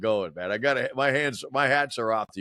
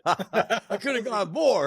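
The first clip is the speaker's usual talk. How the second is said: laughing; 10 semitones higher, slower than usual. Real, not acted. Laughing at the easy deal.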